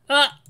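short high, loud yelp of alarm; startled yelp. Acted. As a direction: jump at the remark with a yelp. Startled by the husky's remark.

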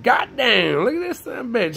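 mock angry Houdini. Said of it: "Houdini was fucking mad at us and said".

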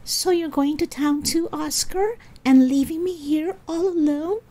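high-pitched complaint from the porch; Susie calling. A narrator's reading. Susie to Oscar as he leaves her alone, high plaintive call. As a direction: pitch it high, as if feeling left behind. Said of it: "Susie called from the porch".

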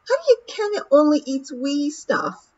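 high pixie voice with a Scottish lilt; a doubtful wee Feegle asking; Woolly's Scottish voice. Acted. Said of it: "said Daft Woolly".

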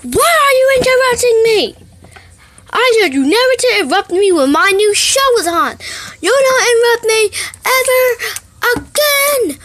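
high, loud furious mom voice; angry scolding. Acted. Mom yelling at kid who interrupted her show, furious.

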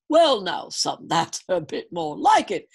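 put-on loud, chummy old dad voice; folksy old sitcom dad. A narrator's reading. King softens, folksy.

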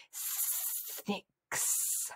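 hissing snake whisper; soft whisper. A narrator's reading. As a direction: whisper it, drawing out the hiss. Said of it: "whispered the soft voice".

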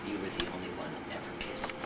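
soft, low, fast murmur in the ear; retold whisper. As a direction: hush it like a confession close to someone. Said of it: "he whispered in my ear".

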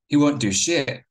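spat out high and loud; a mean teenage jab, loud and high. A narrator's reading. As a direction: sneer it at him with contempt. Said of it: "George spat".

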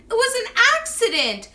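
high, loud protest; wailing. A narrator's reading. Henry excusing himself to Angelina, acted wail. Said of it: "wailed Henry".

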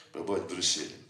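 usual speaking voice

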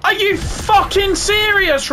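high-pitched furious disbelief; real outrage at the game; squeaky enraged complaint; outraged disbelief. Real, not acted. Dies in the zombie challenge.

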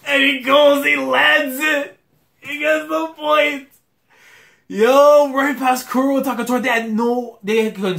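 high, loud winning-point call; excited shout. Real, not acted. Reacting to the winning point landing, high excited shouting. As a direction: scream the point home like a commentator.

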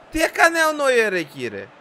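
loud, high incredulous question; amazed disbelief. Real, not acted. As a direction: exclaim at the replay. Watching replay of blunder.

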